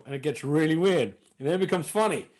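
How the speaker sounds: ordinary talk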